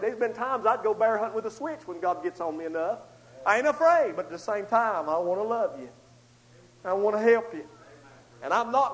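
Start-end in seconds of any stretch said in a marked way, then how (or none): 3.5-4.1 I ain't afraid raised high and louder, afraid falling; preacher's raised proclamation; louder declaration. Shown as a demonstration. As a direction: declare it boldly.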